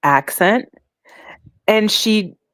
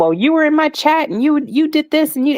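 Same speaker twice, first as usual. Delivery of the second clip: higher, mock accusing voice; retold accusation; 10 semitones higher than usual. Retelling Hussey's false accusations to a friend, mock accusing. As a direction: imitate someone nagging with accusations.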